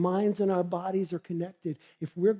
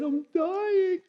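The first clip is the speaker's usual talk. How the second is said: much higher, wailing that she's dying; dramatic insistence; 12 semitones higher than usual. Acted. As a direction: insist on your doom, over the top.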